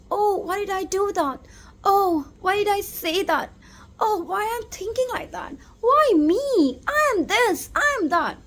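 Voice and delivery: fretful self-blame. Retold or imitated. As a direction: voice the inner critic beating yourself up. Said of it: "the last time you said something like".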